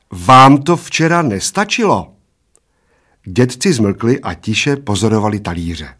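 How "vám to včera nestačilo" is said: slow, raised warning question. A narrator's reading. Waitress warns the two old men, slow and stern. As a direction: warn them, slowly and loudly. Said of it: "varovala oba slovy".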